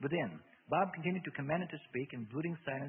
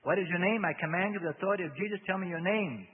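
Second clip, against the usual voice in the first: loud, raised voice of authority; forceful command; 6 semitones higher, 9 dB louder than usual. A narrator's reading. Bob demands the demon's name, loudly commanding. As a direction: demand it as an exorcist.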